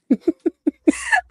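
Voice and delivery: high squealing embarrassed laugh; shrieking laugh. Real, not acted. Volunteer recalling her blunder, high squealing laugh.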